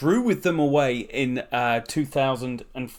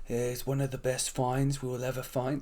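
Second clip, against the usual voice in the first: put-on a terrible, quieter Trump-style American accent; Donald Trump impression; 9 dB quieter than usual. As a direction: do a bad Trump, lightly. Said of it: "That's a terrible accent".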